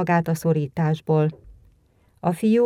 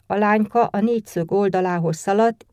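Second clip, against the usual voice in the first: usual speaking voice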